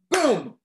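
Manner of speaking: a sudden loud magic boom. A narrator's reading. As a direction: punch it out like a blast. Magic transformation.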